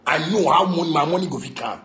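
normal talking voice

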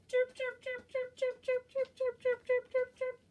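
high squeaky chirp; chick chirp. Pretend.

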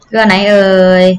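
ordinary delivery

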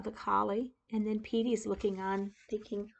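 ordinary delivery

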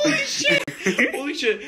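squeals holy shit through laughter; laughing shock. Real, not acted.

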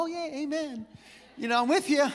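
mock polite, lukewarm amen; half-hearted support. Youth campers humoring a teen who announces he'll preach, half-hearted support. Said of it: "kinda smiled and golf clapped".

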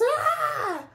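a loud, high shriek of fright; high scream. Acted. Therapist fleeing Camilla's room, loud high scream. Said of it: "The therapist screamed".

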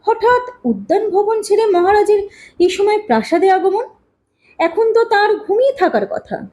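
mild surprise. A narrator's reading. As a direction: wonder aloud at an unexpected arrival. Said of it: "Talata দেবী বেশ অবাক হলেন". (খানিকটা অবাক, উঁচু স্বরে)

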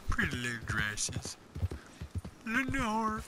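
breathy whispered sad-sack mumble; Bill Dauterive impression. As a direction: moan it like a lonely, depressed cartoon neighbour. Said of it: "I think of Bill Dotrieve from King of the Hill".

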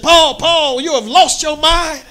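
high, loud scolding of Paul; Festus accusing Paul. Acted. Festus shouting at Paul that he is mad.

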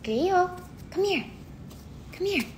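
high-pitched pet call; short, high coaxing calls. Real, not acted. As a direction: coax a pet over in a high little voice. Calling the pet over.

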